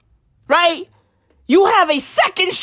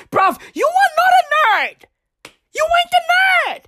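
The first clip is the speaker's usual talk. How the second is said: much higher, yelled scolding; scolding shout; 13 semitones higher, faster than usual. Real, not acted. Telling a clubbing friend he's no nerd, high shouted scolding.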